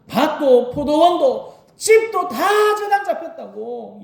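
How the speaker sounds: high, slow, desperate cry; anguished shout. Shown as a demonstration. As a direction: shout out the loss of everything. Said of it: "크게 소리 지르면서 막 죽을 듯이". (다 잃은 사람의 절박한 외침)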